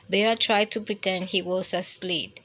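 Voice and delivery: ordinary delivery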